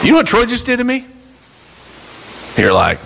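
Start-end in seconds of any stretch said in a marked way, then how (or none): 0.0-1.0 fast, high, breathless tattling; eager gossip. Acted. Church member gossiping to Ethan about Troy, fast and high. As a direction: rush to spill the gossip.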